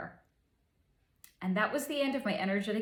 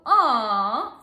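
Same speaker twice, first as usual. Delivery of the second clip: loud, drawn-out "aw"; cooing aw; 8 dB louder than usual. Retold or imitated. From his point of view it's so gross, cooing aw. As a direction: coo it out in mock sympathy. Reacts to his gushing love.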